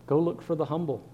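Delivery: ordinary talk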